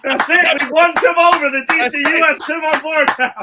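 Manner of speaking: high, loud victory crow; triumphant cheer. Real, not acted. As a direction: gloat loudly that Tim is won over. Celebrating Tim's approval.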